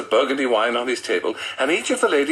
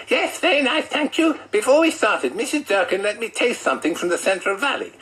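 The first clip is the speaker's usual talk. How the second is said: John's voice; 10 semitones higher than usual. Acted. Narrator voices John answering Janet.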